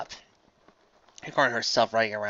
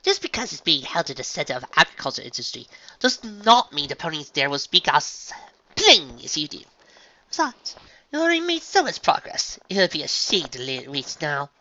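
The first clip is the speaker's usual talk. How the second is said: higher, insistent ladylike voice; fussy unicorn lecturing; 5 semitones higher than usual. A narrator's reading. Unicorn urging farm pony to keep practicing, insistent. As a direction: coach her firmly, with refined insistence.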